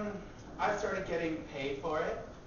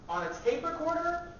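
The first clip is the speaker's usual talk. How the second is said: usual speaking voice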